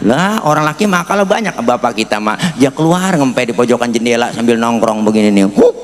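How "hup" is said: ordinary talk, nothing marked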